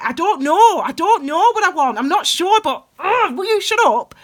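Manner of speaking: high, loud exasperated cry of not knowing, snapping at the end; frustrated outburst. Acted. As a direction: burst out, fed up and confused, then tell them to shut up. Acted frustrated outburst at others.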